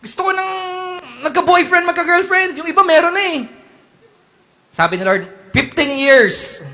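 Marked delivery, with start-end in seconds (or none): 0.1-3.4 put-on impatient teen. Teen voice continues. 5.5-6.6 slow, drawn-out divine verdict; mock voice of God. Preacher voicing God's slow answer to the teen.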